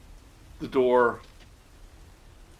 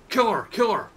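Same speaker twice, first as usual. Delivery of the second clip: shrill shouted order, much higher and louder; cultist shouting; 10 semitones higher, 8 dB louder than usual. Acted. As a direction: scream the order in a panic. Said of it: "It's like".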